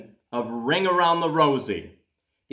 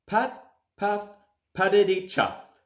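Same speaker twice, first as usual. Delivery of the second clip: a playful, rhythmic tractor putter; puffing little tractor noises; chugging engine sounds; 5 semitones higher than usual. A narrator's reading.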